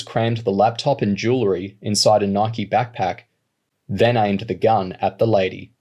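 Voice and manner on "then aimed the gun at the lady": ordinary delivery, nothing marked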